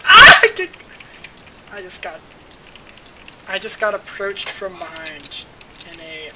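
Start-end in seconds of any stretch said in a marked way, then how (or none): 0.0-0.4 loud high scream; startled scream. Real, not acted. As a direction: shriek at a surprise attack. Jumped from behind in game.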